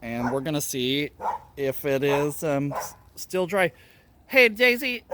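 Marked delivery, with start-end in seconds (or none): 4.3-5.0 loud call to the dog. Real, not acted. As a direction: call the dog's name. Calling the dog.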